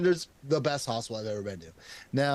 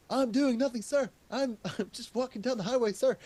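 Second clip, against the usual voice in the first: much higher, timid polite voice to a cop; meek innocent act; 12 semitones higher than usual. Retold or imitated. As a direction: play the harmless good boy for the officer. Answering a patrolman on highway.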